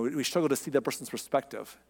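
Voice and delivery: ordinary talk, nothing marked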